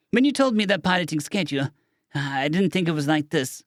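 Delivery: ordinary delivery, nothing marked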